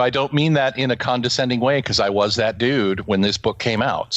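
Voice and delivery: normal talking voice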